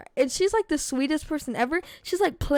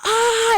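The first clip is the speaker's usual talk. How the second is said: high, loud "ah"; mock scream at the sight of injury; retold scream; 9 semitones higher, 6 dB louder than usual. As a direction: reenact a scream of pain. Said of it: "I screamed, like, really loud once".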